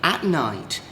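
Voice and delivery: ordinary delivery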